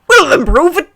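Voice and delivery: high, loud put-on challenge; hammed-up retort. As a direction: fire the challenge back at full volume. Hagrid challenges screeching aunt.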